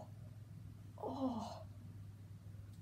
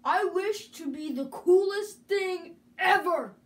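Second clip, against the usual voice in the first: ordinary talk, nothing marked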